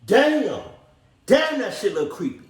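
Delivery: ordinary delivery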